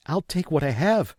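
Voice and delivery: a hint of a startled mother giving in; mother jumping up in alarm; mother, hurried. A narrator's reading. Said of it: "she said, jumping to her feet".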